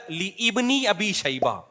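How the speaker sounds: "لی ابن" slow and split, li then ibni; over-careful li-IBNI in "لی ابن"; mock correction. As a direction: draw out "لی ابن ابی شیبہ" with the alif sounded. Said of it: "اصل لفظ ہے".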